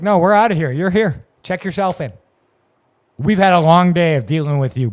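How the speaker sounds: imitating an impatient cop; cop brushing him off. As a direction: shoo him off like a tired cop.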